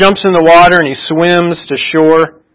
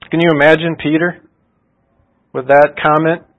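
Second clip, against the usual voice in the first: ordinary talk, nothing marked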